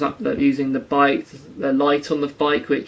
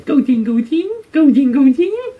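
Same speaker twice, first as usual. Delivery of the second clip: much higher, louder, silly repeated creature call; Goldeen cry; 11 semitones higher, 7 dB louder than usual. Retold or imitated. Imitating Goldeen flopping about uselessly, silly high cries. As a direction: flap out the name like a helpless fish. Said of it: "Goldeen just flops about going".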